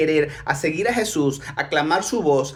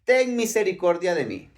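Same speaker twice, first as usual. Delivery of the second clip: raised, high-pitched plea; high calling voice, easing toward the end; crying out; 6 semitones higher than usual. Acted. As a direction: call out for mercy over a crowd. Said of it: "comenzó a dar voces y a decir".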